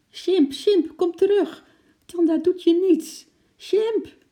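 high, loud pleading shouts; a girl screaming for her monkey to come back; desperate calling. A narrator's reading. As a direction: call him back, begging and frantic. Girl chasing her fleeing monkey.

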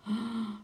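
hushed, breathy astonished gasp; soft gasp of wonder. A narrator's reading. Uncle watches the squirrel hop in, soft astonished gasp. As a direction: catch your breath in quiet amazement.

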